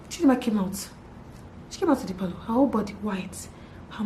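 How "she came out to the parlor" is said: ordinary talk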